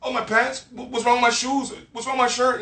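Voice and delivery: high, anxious self-questioning; self-conscious worry. Retold or imitated. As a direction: fret over your clothes in a high voice. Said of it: "'Cause then you're like".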